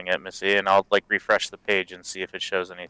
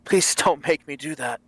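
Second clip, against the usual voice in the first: pleading prisoner; 6 semitones higher than usual. Acted. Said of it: "He says".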